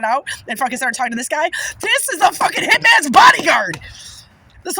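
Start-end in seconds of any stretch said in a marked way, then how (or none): 1.8-3.8 higher, louder triumphant shout; excited shout. Real, not acted. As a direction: proclaim it like a revelation. Excited revelation of protection.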